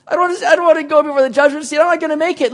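high, fast, panicky mock whine; scared believer. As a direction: play the frightened churchgoer dreading judgment. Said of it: "a lot of people look at that and go".

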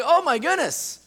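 jolted awake and suddenly eager; startled congregation. Pretend. Congregation startled by a heavenly voice, high loud excited. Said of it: "you'd be like".

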